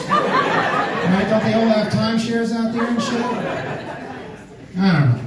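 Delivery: ordinary talk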